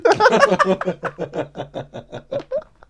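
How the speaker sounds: big burst of laughter; loud high laugh. Real, not acted.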